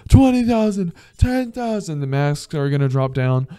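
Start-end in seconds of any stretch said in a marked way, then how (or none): 0.1-2.0 high, frantic countdown of the plane falling; panicked rising countdown. Pretend. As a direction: climb higher in pitch with mock panic on each number. Imagined plane plunging.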